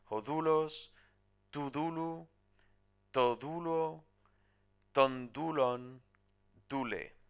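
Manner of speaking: teacher recites the declension of dulos for students to memorize, slow and clear; Greek case endings. Shown as a demonstration. Teacher to students: spelled-out Greek endings, each ending stressed. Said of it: "Podéis memorizar cualquiera de estas".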